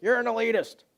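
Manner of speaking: high, loud accusing jab; a heckler calling out an elitist, in a high mock yell. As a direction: fling it like an indignant heckler. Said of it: "somebody yells out from the audience".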